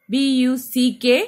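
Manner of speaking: B यू सी के spoken letter by letter, slowly; spelling out letters. Shown as a demonstration. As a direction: spell B यू सी के one letter at a time. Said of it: "यहाँ से divide". (अक्षर अलग-अलग बोलना)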